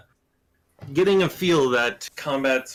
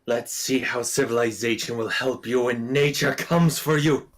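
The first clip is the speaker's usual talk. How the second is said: a touch of a grand druid calling nature; menacing druid; 2 semitones higher than usual. Acted. Druid NPC taunts then invokes nature.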